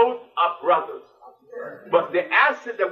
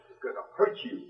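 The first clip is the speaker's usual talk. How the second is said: whispered warning; 2 semitones higher, 10 dB quieter, faster than usual. Acted. Warning listeners about eating meat.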